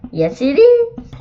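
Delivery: high, slow, prim monster voice with a sing-song lilt; monster Ralph. Acted. Monster greeting a child in a high, prim voice. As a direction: play a well-groomed monster introducing himself. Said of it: "Ralph replied".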